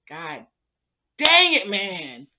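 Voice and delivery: sudden loud burst on dang it; frustrated outburst. Real, not acted. Vlogger reacting to the scene, an exasperated outburst. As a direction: blow up in exasperation, then drop.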